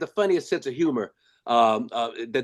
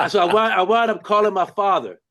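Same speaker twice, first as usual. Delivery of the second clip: usual speaking voice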